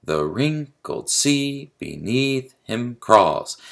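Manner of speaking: slow, drawn-out "The wrinkled sea beneath him crawls", lifting wrink-, sea, -neath, crawls; exaggerated iambic stress. Shown as a demonstration. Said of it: "alternating between unstressed and stressed syllables".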